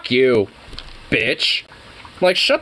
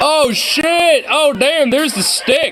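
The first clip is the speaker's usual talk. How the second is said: excited reaction; 11 semitones higher, slower than usual. Real, not acted. Reacting to fight video.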